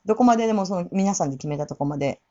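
ordinary talk, nothing marked

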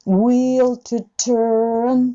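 slow will to turn; ゆっくり; slow demo. Shown as a demonstration. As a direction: draw will to turn out slowly. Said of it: "この人だったらturnって上げてるけど".

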